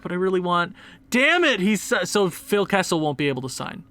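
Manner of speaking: ordinary talk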